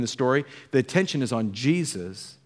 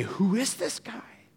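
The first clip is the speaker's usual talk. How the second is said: hushed, slow, higher amazed question; awed wonder; 6 semitones higher, 5 dB quieter, slower than usual. Acted. Stunned disciples ask about Jesus, hushed and slow. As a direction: gasp it out in disbelief.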